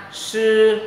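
"sư" as a long high hushing sound; tongue-curled SƯ. Shown as a demonstration. Teacher reads S H, tongue-curled "sư". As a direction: curl the tongue back and hold "sư". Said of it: "uốn lưỡi".

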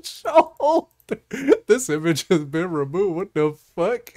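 loud, high-pitched real laughter through the words; cracking up. Real, not acted. Host rereads own bizarre image prompt, laughing hard. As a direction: read it out while losing it laughing.

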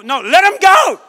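high, loud shout; Moses shouting. Acted. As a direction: bellow it back at him.

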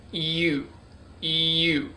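"U" with a stressed y at the front; emphasis on Y. Shown as a demonstration. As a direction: build up the y before opening into "U". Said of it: "you put emphasis on the Y".

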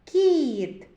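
кіт high and loud with long і; кіт announced clearly. Shown as a demonstration. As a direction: present кіт with a stretched vowel. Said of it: "Звуковий аналіз слова".

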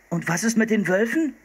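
put-on high-pitched boy calling after someone. A narrator's reading. Boy calling after the departing hunter, high urgent question. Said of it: "rief Philipp".

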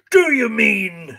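slow, high, loud outrage; booming indignant master; thundering. A narrator's reading. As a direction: thunder it, dragging out the words. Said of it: "his master thundered".

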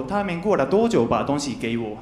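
ordinary delivery

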